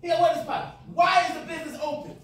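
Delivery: loud, high, insistent questions; loud quizzing. Real, not acted. Teacher quizzing the class, loud and high. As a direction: push the class for the answer.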